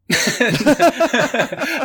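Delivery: loud, high burst of real laughter; genuinely cracked up. Real, not acted. Developer bursting out laughing at the question, loud and high.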